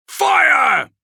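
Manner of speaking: sudden high, loud shout of command; barked order. Acted. Kravrek ordering his men to fire, a loud shout. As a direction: yell the order to open fire.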